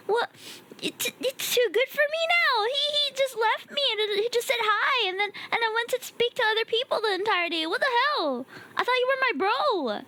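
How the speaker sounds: high-pitched, playful outrage; mock indignation. Streamer scolding a friend who ignored them, mock indignation. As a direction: complain like a jilted pal, hammed up.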